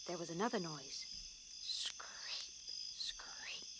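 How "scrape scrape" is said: soft whispered scrape. A narrator's reading. Narrator whispering the branches scraping the house. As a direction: breathe the scraping sound.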